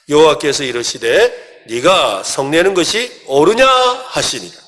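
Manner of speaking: slow, even reading of the verse together; group scripture reading. Real, not acted. Pastor leading the congregation in a slow, even verse reading. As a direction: read the verse aloud slowly. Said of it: "같이 한번 읽겠습니다".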